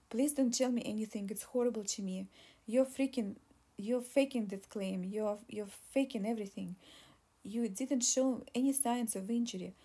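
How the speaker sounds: ordinary delivery, nothing marked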